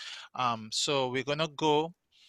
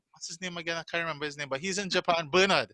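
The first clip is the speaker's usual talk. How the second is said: ordinary talk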